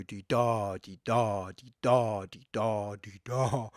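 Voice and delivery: D-da with the da hit harder each time; iambic beat. Shown as a demonstration. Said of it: "the da sounds a bit stronger".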